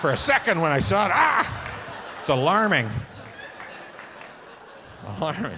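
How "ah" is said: sudden high, loud cry of alarm; startled yelp. Retold or imitated. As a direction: yelp as if you just saw a monster. Startled by the tiny-headed giant.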